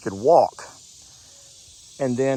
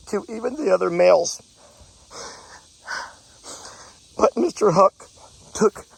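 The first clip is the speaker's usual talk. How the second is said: real emotional breakdown; 7 semitones higher than usual. Real, not acted. Farmer telling how the goat protected the herd, tearfully emotional. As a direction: voice pitched high, choking up in tears. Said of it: "I'm gonna get emotional talking about it".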